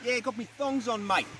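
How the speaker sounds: mock Australian accent. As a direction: play along in a put-on Australian accent. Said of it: "my terrible Australian accent".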